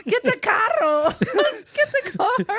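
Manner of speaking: high, loud mock Cuban accent, laughing; hammed-up Cuban-accent scream. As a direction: yell it like a Cuban, cracking up. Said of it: "like you're a Cuban screaming".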